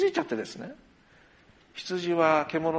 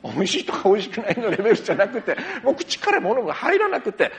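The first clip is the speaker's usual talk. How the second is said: 笑いながら; 5 semitones higher, 6 dB louder than usual. Real, not acted.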